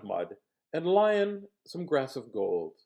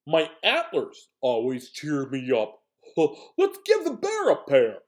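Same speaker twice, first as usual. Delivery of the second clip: Moose's voice; 8 dB louder than usual. Acted. Moose pitches antlers to the others, loud. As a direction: boom out the Moose's idea. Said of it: "Moose complained".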